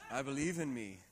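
a hint of a TV preacher's upbeat confidence; Joel Osteen. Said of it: "That's Joel Osteen's influence on me today".

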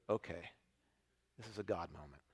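hushed, awed inner thought; hushed awe. Retold or imitated. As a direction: say it like a private realization.

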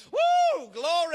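very high, loud praise shout; exultant whoop. Real, not acted. Preacher bursts out praising God, exultant whoop. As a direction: whoop it out, then cry glory.

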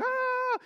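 sudden high yell; mock war cry; high battle cry. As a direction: shout like a charging army. Army shouting in battle.

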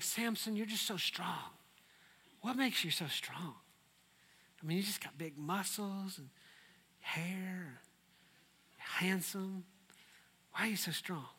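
hammed-up sweet talk; mock seductive Delilah. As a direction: purr the flattery to him. Delilah voiced coaxing Samson.

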